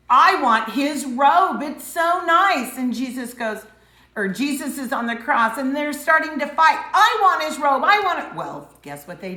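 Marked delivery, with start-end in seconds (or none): 0.1-2.8 loud, slow, higher covetous whine; greedy. Acted. Soldier coveting Jesus's robe, loud slow greedy whine. 6.9-8.3 loud, higher grabbing demand; greedy squabbling. Acted. Soldiers fighting over the robe, loud higher greedy demand.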